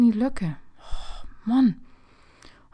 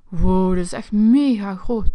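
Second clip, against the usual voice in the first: ordinary talk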